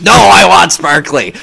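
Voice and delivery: high, loud mock demand; insistent demand. Acting out insistent requesters.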